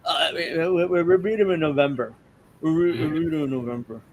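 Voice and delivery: stammering hammed-up imitation; halting, unsure politician parody; mock awkward Kamala. Host mocking her awkward delivery for co-hosts. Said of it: "she's kind of just like".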